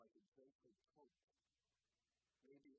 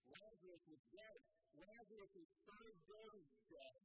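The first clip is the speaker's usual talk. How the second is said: louder, slow, rising preacher's cry; full-force preaching; 5 semitones higher, 4 dB louder, slower than usual. Acted. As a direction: preach it, building with every phrase.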